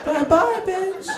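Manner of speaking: high, sing-out goodbye; mock cheery farewell. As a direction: toss off a high-pitched, gleeful send-off. Mock farewell while pushing someone off.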